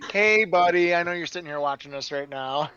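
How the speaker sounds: normal talking voice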